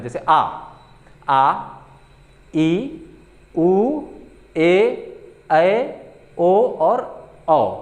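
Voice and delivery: आ, ई, उ, ए, ऐ, ओ, औ each held long, twice the short length; teacher showing long vowels; long vowel demo. Shown as a demonstration. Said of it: "उससे दोगुना time लगता है".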